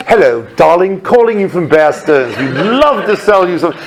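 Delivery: put-on theatrical English accent, "darling" style. Intern cold calling a darling client, mock English accent. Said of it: "English accent and all".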